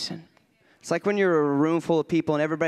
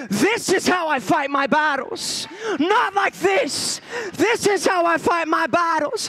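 high, shouted revival preaching; full-force preaching; 13 semitones higher, 6 dB louder than usual. Real, not acted. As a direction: drive it home like a fired-up preacher.

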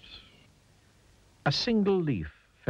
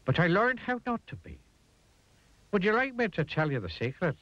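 higher-pitched, coaxing tramp voice; kindly tramp; 11 semitones higher than usual. A narrator's reading. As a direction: offer a secret to a nervous chap. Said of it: "said the tramp".

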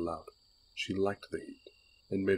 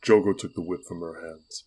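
plain reading voice

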